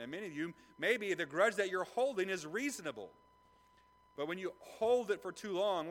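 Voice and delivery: high, loud sermon voice; impassioned preaching; full-force preaching. Acted. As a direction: drive the point home to the congregation.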